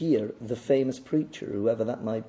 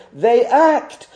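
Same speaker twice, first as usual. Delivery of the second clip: "act" hit firmly at raised pitch; stress on ACT; 8 semitones higher, 5 dB louder than usual. Real, not acted. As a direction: drive home the word "act". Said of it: "if I could just stress this word".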